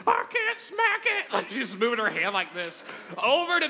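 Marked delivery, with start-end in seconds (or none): 0.1-1.3 high, slow whiny wail; scared girlfriend. Retold or imitated. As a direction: mimic her helpless squeal. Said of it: "She's like".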